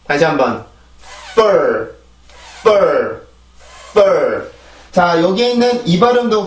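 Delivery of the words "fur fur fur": "fur" with a long rush of air on f before the stressed vowel; air-driven f. Shown as a demonstration. Teacher showing how air launches the stress, air-driven f. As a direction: push breath through the f, then land on "fur". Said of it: "그 소리가 많이 나오고 나서 내가 강세로 올라가 주는".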